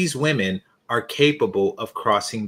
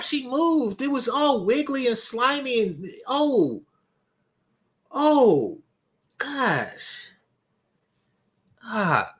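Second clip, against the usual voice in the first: high, wailing disbelief; reeling in horror; 10 semitones higher than usual. Real, not acted. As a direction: moan in high-pitched, hammed-up shock. Reeling from the horrifying clip.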